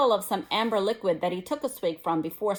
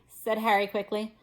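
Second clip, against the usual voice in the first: ordinary delivery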